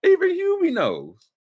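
mock shocked exclaim; mock disbelief. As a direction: hit even Hubie high and loud. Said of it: "I was like".

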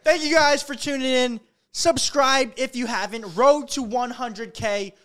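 hyped channel outro; vlog outro. Acted. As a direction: pump up the subscribers. Outro announcement.

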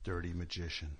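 low, soft mumbled title; quoting Lil Chomps. Retold or imitated. Quoting a kid's version of the title, low and soft. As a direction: mumble it like the kid says it. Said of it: "That's what Lil Chomps says".

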